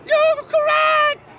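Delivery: loud, high, slow cheer; excited shout. Real, not acted. Host cheers correct answer.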